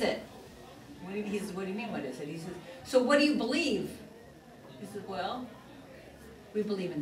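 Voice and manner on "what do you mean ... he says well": ordinary talk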